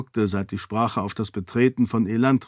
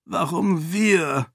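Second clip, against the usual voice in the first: langsam und gequält; stöhnend; 7 semitones higher, slower than usual. A narrator's reading. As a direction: ein widerwilliges, langgezogenes Klagen. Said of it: "stöhnte Edan". (Edan klagt den Lords gegenüber stöhnend und langsam)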